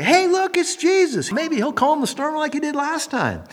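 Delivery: high, bright call of recognition; excited shout. Pretend. Disciples spotting Jesus on the water, excited call.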